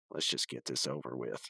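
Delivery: sigh, then low and soft resignation; Rigby impression. Rigby reluctantly starting the game, low, weary delivery. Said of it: "just the tone of his voice".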